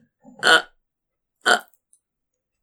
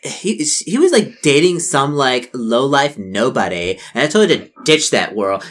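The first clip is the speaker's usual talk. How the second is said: much higher and louder, scoffing; dismissive mean girl; 4 semitones higher, 5 dB louder, slower than usual. Acted. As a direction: brush off the loser boyfriend.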